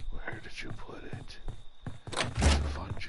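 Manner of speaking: breathy searching whisper. Real, not acted. Player hiding from the killer, whispered search. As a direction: hush it while searching.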